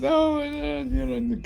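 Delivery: high-pitched surprised gasp; a sudden high oh of surprise. Retold or imitated. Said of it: "Jean-Paul goes".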